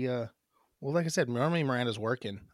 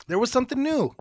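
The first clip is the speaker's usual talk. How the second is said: much higher, louder announcer call; loud announcing; 12 semitones higher, 7 dB louder, faster than usual. Shown as a demonstration. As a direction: belt it out like a host. Retakes line high and loud.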